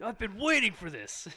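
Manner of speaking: high, loud, slow mock relish; hammed-up eager neighbor. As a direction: savour the long-awaited moment, loudly playacting. Acts neighbor eager with crossbow.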